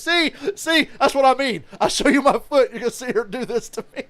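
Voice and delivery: high, loud mock whine of Deion; imitating Deion. Deion complaining to Shannon about his foot, high mock whine. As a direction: squeal it like a wounded Deion. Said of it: "And Deion goes".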